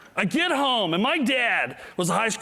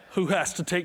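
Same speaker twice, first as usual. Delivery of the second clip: normal talking voice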